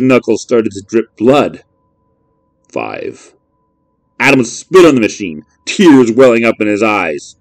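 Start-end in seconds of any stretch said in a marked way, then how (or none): none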